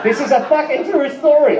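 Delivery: high, agitated retort; acted outburst, pitched high; worked-up protest. Acted quote, alarmed in the tale.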